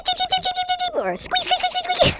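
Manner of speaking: very high, rapid squeaky chirps; silly squeaking dolphin imitation; dolphin sounds. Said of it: "the sound they make is like this".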